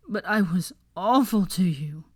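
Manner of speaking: tearful confession, voice breaking. A narrator's reading. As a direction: let the voice crack on it. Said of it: "his voice breaking".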